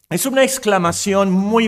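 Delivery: ordinary talk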